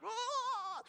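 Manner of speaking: soft, high imitated wail to God; mock wail. Showing how he wailed to God.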